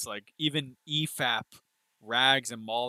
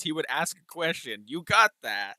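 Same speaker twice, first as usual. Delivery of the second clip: normal talking voice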